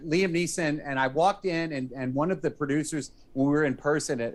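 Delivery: normal talking voice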